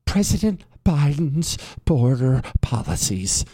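imitating her earnest delivery; mock earnest senator voice. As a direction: parody the solemn speech line. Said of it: "She got crushed for that".

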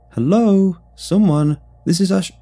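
loud, high calls for anyone at all; shouting. A narrator's reading. Brom calling for someone in the deserted town, shouting. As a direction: call out hard across an empty town. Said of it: "Brom shouted as loudly as I could".

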